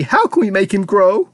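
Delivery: high, pleading wail. A narrator's reading. Mother begging Wonka for a cure, wailing. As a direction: wail the question, imploring. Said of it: "wailed Missus T V".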